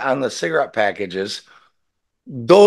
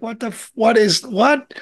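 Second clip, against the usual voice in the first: incredulous; 9 semitones higher than usual. Retold or imitated. Baffled retort in a retold scene.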